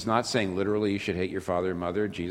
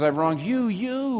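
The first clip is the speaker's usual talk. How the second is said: "you" repeated, second one leaping much higher; doubled you; 11 semitones higher, slower than usual. Retold or imitated. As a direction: let the doubled "you" cry out. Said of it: "a Semitic way of getting across intense emotion".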